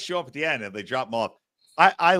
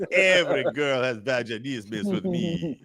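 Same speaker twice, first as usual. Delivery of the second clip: put-on slow North African accent; boastful North African accent; 2 semitones lower than usual. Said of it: "I did a perfect North African accent".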